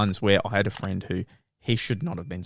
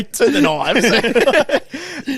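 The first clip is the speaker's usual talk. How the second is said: higher, louder, very slow, laughing; drawn out for the joke; laughing slow punchline; 13 semitones higher, 8 dB louder, much slower than usual. Retold or imitated. Joking to his friend about the knives, slow and laughing.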